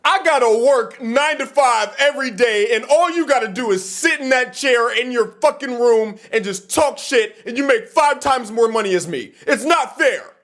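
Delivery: high whiny voice of a complaining nine-to-five worker; whiny complaining fan. Retold or imitated. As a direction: mimic a jealous fan whining. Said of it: "This dude was like".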